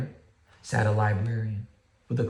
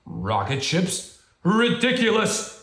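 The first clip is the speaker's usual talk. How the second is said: getting louder; 9 semitones higher, 5 dB louder, slower than usual. Acted. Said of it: "Bear's voice was getting louder".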